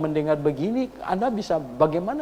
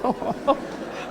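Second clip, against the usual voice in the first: breathy embarrassed laugh; awkward laugh; 10 semitones higher, 3 dB quieter than usual. Real, not acted. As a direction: laugh it off nervously. Pastor laughs at own lie. (tawa canggung)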